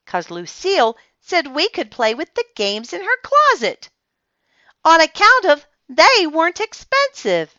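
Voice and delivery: high, loud bubbly storyteller; child telling friends about the fun part; perky. A narrator's reading. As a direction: brighten up with relief. Narrator's cheerful turn.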